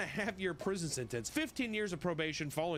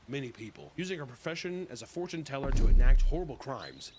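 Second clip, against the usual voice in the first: ordinary talk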